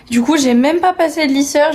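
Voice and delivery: normal talking voice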